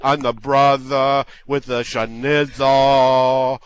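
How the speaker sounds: mock Brooklyn street drawl, slowing at the end; hammed-up Brooklyn accent, stretched low final words; Brooklyn homeboy accent. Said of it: "shout out to the homeboys in Brooklyn".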